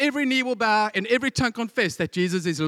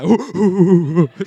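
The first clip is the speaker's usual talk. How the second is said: giddy hyena cackle; 3 semitones lower, 9 dB louder than usual. Retold or imitated. Hyenas giggling at the name.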